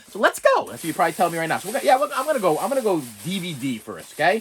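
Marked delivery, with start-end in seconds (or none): 0.2-0.7 mock chirpy sat-nav voice, much higher; sing-song robot cheer; navigation lady. YouTuber imitating the map app voice.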